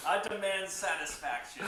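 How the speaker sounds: Southern gentleman. Acted. Southern gentleman duel joke.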